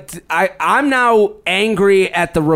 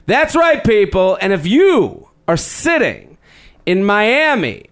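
announcer voice; 4 semitones higher, slower than usual. Acted. Live show announcement hype.

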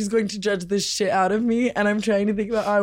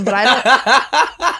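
laughing; 2 semitones higher, 4 dB louder, much slower than usual. Retold or imitated. Drawn-out laughing punchline.

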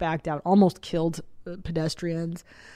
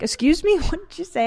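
much higher, disbelieving mom; shocked question; 10 semitones higher than usual. Retold or imitated. Mom questions son after hearing him curse, shocked. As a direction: pitch it up in mock shock.

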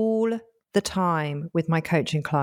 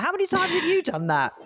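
mock disbelief; 10 semitones higher than usual. Mock-incredulous retort to co-host.

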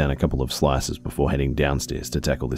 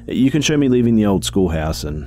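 officer on the radio; 6 semitones higher, 4 dB louder than usual. Acted.

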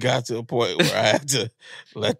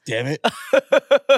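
slow, drawn-out, higher and louder echo; mock echo; 11 semitones higher, 7 dB louder, much slower than usual. Cohost teasingly echoing his friend's complaint, slow and loud. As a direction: stretch it out to tease.

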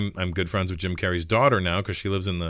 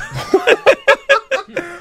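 big high laugh; 7 dB louder than usual. Real, not acted. Laughing at the radio host's praise, a big loud laugh. Laughing at his own joke.

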